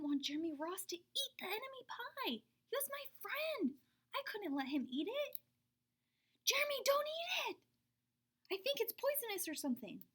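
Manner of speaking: high urgent panicked plea. Acted. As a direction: beg him to stop. Panicked warning.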